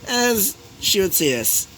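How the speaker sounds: usual speaking voice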